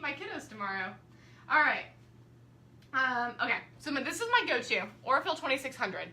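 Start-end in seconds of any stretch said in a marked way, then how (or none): none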